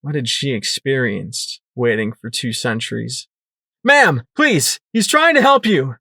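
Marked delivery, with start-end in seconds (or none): 3.8-5.9 high, loud pleading shout; yell. Acted. Narrator shouting at the panicked mare, yelling. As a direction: yell to get through her panic. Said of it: "I yelled".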